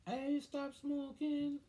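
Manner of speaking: very high, drawn-out mock mom voice; girlfriend's mom. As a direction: sing out a greeting in a put-on falsetto. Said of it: "her mom just come out of nowhere".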